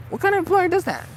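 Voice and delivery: very high, loud squeal of disbelief; outraged squeal about the stalking boss. Real, not acted. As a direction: shriek it in outrage. Outrage at employer stalking her.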